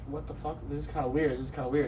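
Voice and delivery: low, hushed aside between friends; retold uneasy muttering. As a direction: mutter it under your breath, imitating them.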